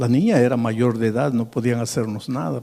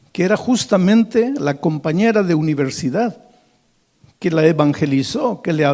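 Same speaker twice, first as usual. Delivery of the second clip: higher, louder sermon build-up; preaching; 5 semitones higher, 5 dB louder than usual. Acted. Scene: a preacher tells of the friend at the grave, fervent. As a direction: press the story forward with fervor.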